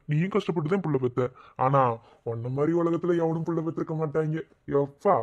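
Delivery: usual speaking voice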